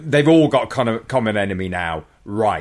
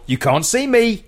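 taunting villain; 10 semitones higher than usual. Retold or imitated. Voicing invisible Randall in the fight.

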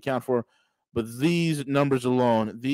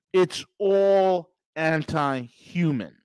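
slow emphatic; much slower than usual. Real, not acted. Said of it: "slow emphatic repeat".

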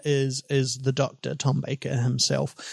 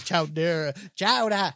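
bad Boston accent, pitched up; 3 semitones higher, slower than usual. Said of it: "can you do a Boston accent".

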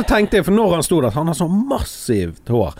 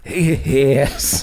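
imitating Bjarte; much slower than usual. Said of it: "så bare".

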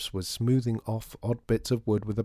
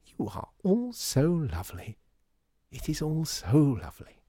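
gushing; 7 semitones higher than usual. Acted. Customer thanking the shopkeepers, gushing delight.

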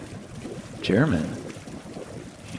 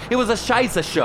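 heated; 12 semitones higher than usual. Acted. Husband ranting about ambush.

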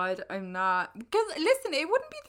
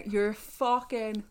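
ordinary delivery

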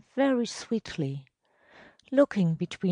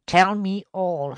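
louder, higher firm order; commanding; 4 semitones higher, 7 dB louder than usual. A narrator's reading. Superintendent commands the elder to tell the truth, commanding. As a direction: order him to speak up. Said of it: "Michael commanded him to speak".

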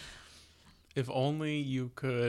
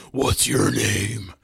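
devil voice; 3 semitones lower, 8 dB louder, much slower than usual. Retold or imitated. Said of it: "devil voice".

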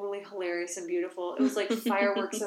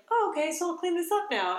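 put-on high breezy kid; cheerfully casual like a child; high mock child; 9 semitones higher, 4 dB louder than usual. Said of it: "it was like".

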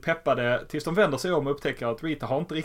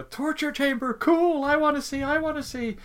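put-on very high-pitched, thrilled child shrieking; excited kids' squeal. Kids clamouring to see the torture chamber, excited squeal.

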